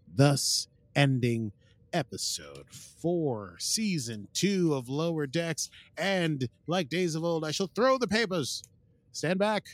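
slow, high, theatrical proclamation; mock-grand announcement. Host ending recap before throwing papers, mock-grand proclamation. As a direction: build to a ceremonial flourish.

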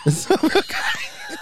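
put-on high, slow, laughing Japanese accent; stretched-out mock Japanese accent. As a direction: giggle through a fake Japanese accent. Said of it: "give us a little Japanese".

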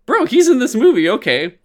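a fan's hammed-up shout of recognition; excited outburst. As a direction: blurt it out like a thrilled viewer spotting an actor. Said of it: "you're just kinda like".

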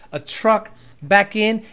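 ordinary talk, nothing marked

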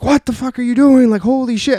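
high, loud imitated freak-out; retold panic. Girlfriend panics after sudden swerve.

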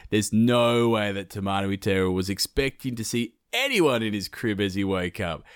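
usual speaking voice

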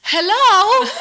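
very high, very loud hammed-up hello; hammed-up hello at her sister; mock hello. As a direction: squeal out a pointed hello. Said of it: "and you go".

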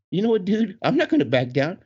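high, accepting the dare; defiant buddy. Retold or imitated. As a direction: refuse to back down.

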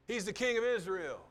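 loud, high sneering jeer; a preacher voicing the jeering crowd at the cross; mocking. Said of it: "They're mocking".

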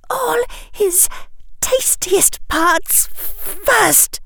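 put-on very high, strained girl's voice; terrified. A narrator's reading. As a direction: answer in slow, high-pitched horror. Dazed terrified girl answers.

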